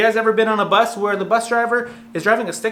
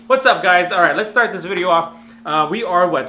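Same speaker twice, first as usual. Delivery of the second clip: normal talking voice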